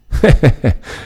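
short, high acted chuckle. Friar answers where the town is, acted little laugh.

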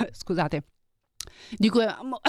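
voce strozzata, mentre tossisce; choking on a drink. Real, not acted. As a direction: parlare soffocando dopo un colpo di tosse. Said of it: "mi sto strozzando". (voce che si strozza)

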